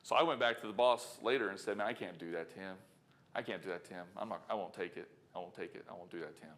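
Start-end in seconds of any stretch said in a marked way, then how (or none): 1.8-6.5 low, soft, earnest refusal; sincere plea. Retold or imitated. [name] telling the boss softly he won't take the money. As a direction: quietly insist he can't take it.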